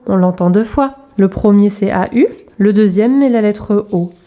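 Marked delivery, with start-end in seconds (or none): none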